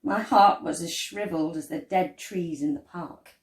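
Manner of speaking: ordinary delivery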